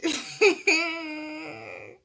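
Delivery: long, high, loud laugh; incredulous laugh. Real, not acted. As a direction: burst out laughing in disbelief. Laughs at the shocking discovery.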